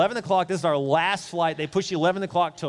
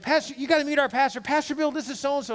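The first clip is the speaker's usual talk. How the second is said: higher, bubbly church lady introducing her pastor; chatty church lady; 10 semitones higher than usual. Retold or imitated. Kathy introduces stranger to her pastor, higher bubbly voice. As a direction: gush like an eager introducer. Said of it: "she's like".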